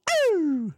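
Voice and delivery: sudden high mock yelp. Streamer reacting to a movie scene with a high yelp. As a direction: yelp like something hurt you.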